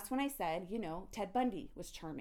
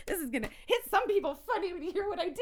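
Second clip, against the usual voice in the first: laughing much higher and louder; real laughter tipping into crying; 14 semitones higher, 7 dB louder, faster than usual. Real, not acted. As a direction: laugh through it, near tears. Laughs while telling story.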